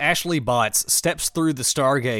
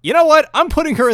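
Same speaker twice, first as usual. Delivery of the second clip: exasperated; 10 semitones higher, 9 dB louder than usual. Real, not acted. Exasperated at terrible lip sync.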